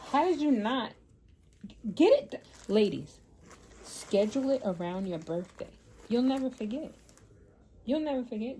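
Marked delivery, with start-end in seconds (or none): 0.1-2.4 rising, much louder disbelief that cuts off; can't believe what she's hearing; incredulous. Retold or imitated. Telling nurse she skipped her mammogram, incredulous rising outburst.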